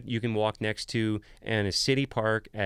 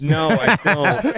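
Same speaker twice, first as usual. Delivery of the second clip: laughing; 6 semitones higher, 10 dB louder, much slower than usual. Real, not acted. Laughing answer.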